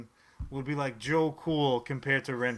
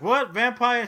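higher, louder mock excitement; mock excited; 7 semitones higher, 7 dB louder than usual. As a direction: perk up at the word vampire. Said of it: "He's like".

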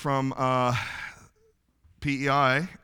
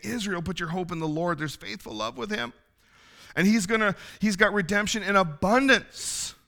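cry of hope; 7 semitones higher than usual. Retold or imitated. Prophet calling Israel to hope in the Lord, imitated cry. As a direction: cry it out hopefully, pitched well up, slowing on the last word. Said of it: "he has this cry of ho-, of hope".

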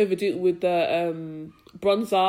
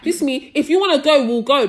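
higher and louder, squaring up; louder mock dare to fight; retold fight challenge; 5 semitones higher, 7 dB louder than usual. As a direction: throw down the challenge.

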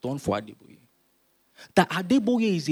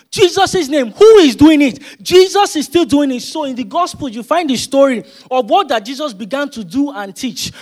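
high, loud preaching, near shouting; full-force preaching; 9 semitones higher, 13 dB louder than usual. Acted. Preacher to congregation, declaring in Jesus' name at full force. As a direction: rouse the congregation at the top of your voice.

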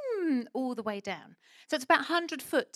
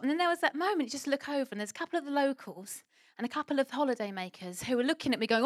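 ordinary talk, nothing marked

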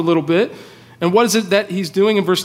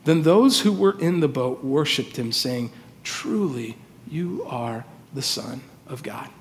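low, soft, reverent reading; hushed scripture reading; 5 semitones lower, 7 dB quieter than usual. A narrator's reading. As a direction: let the verse sink to a hush. Reads closing verse of scripture reverently.

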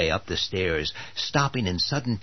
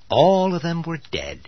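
dramatic; 8 semitones higher, 5 dB louder than usual. A narrator's reading. Narrator's dramatic reveal.

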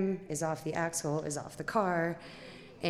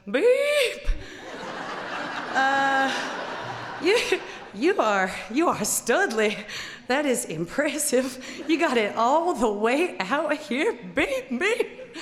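loud laughing bleeped praise, slow in places; amazed rowdy trucker; 8 semitones higher, 8 dB louder, slower than usual. Retold or imitated. The giant looking at her wrecked car, loud and amazed. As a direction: marvel at the crash with a laugh. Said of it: "he goes".

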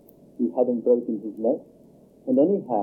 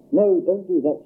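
cried out; 6 semitones higher, 4 dB louder than usual. A narrator's reading. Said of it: "she cried".

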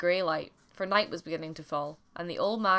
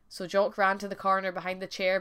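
plain reading voice

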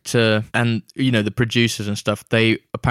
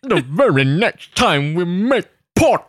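slow percussive villain delivery; drawn-out, theatrical sea-captain menace; Davy Jones impression; 3 semitones higher, slower than usual. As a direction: savour every phrase, popping the consonants. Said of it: "That, that percussive sound as well".

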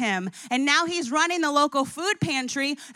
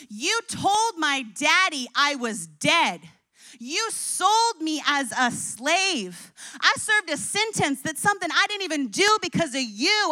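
bitter accusation; 4 semitones higher, 3 dB louder than usual. Pretend. The other way Joseph could have answered his brothers, rising anger. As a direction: lay each charge down, then explode. Angry alternative Joseph response.

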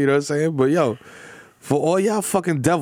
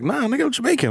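mock indignant reply; 11 semitones higher than usual. Acted indignant reply to origin question.

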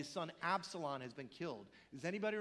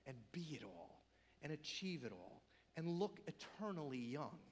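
usual speaking voice

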